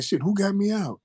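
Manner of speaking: 풀려나 놀란 높은 목소리; puzzled exclamation. Retold or imitated. Surprised at release.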